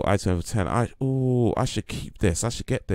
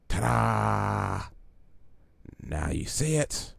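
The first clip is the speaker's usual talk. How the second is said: lightly voiced theatrical trickster villain; Joker; 6 dB quieter, slower than usual. Streamer continuing the Joker's film lines, light Joker impression. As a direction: present a trick as the villain would. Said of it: "do the Joker".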